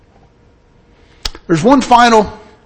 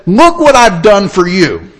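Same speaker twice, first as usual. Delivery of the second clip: louder, slow, resentful protest; indignant older son; 5 semitones higher, 5 dB louder than usual. Acted. Older son to his father, loud indignant complaint. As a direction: throw your years of loyalty back at him. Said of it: "the older son was indignant".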